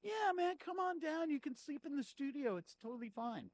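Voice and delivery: high-pitched imitation of a casual guy; breezily reassure, sending him up; mock breezy host. Mocking studio owner's breezy reassurance.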